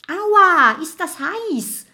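very high, loud yelp easing off; pained cry. A narrator's reading. Mathilda gets burnt in the chimney, crying out high. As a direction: yelp as if scorched. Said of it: "ruft Mathilda".

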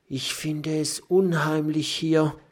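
high, nervous porcupine voice; timid porcupine voice, a touch scared; uneasy whisper. A narrator's reading. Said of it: "flüstert Mathilda".

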